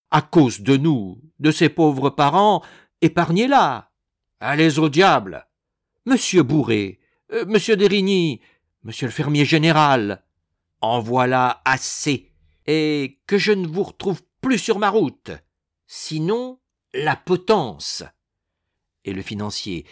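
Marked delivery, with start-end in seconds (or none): 0.1-3.9 loud, urgent plea rising higher; pleading. A narrator's reading. Mother begging son-in-law to spare her daughter, pleading. 4.4-18.0 loud, exasperated dismissal; angry threat. A narrator's reading. Financier threatening his in-laws with the gallows, furious.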